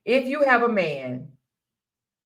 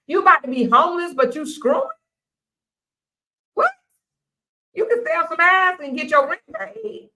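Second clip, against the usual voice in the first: incredulous rant; 9 semitones higher than usual. Real, not acted. Host outraged at women supporting broke men, much higher. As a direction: fire off disbelieving questions.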